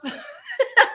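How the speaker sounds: bursting into a high laugh; high burst of laughter. Real, not acted. Laughing at own impression.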